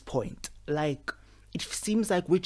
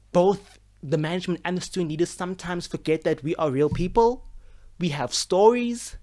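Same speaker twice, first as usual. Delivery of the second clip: usual speaking voice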